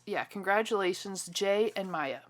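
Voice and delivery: usual speaking voice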